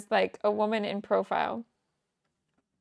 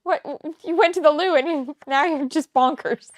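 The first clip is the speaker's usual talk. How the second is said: higher, louder baffled disbelief; baffled friends; 7 semitones higher, 8 dB louder than usual. Retold or imitated. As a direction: sound like your friend thinks you have gone mad. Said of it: "my friends were looking at me like".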